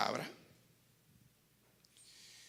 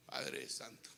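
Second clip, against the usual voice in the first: unvoiced, much lower prayer; whispered prayer; 10 semitones lower, 7 dB quieter, slower than usual. Real, not acted. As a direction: breathe it as a prayer. Oración breve. (oración susurrada)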